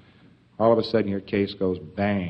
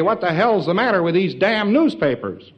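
higher, louder indignant griping; mock indignant outburst; 7 semitones higher, 4 dB louder than usual. Townspeople complaining about the newspapers, higher and louder. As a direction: grumble like an outraged citizen. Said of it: "they'd say".

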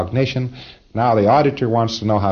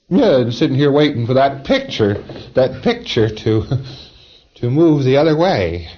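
lightly voiced PC; 5 semitones higher, 3 dB louder than usual. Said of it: "PC answer acted".

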